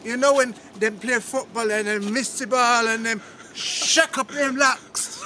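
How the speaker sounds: mock Jamaican accent. Friend auditions as [name], mock Jamaican. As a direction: play a hammed-up Jamaican storyteller.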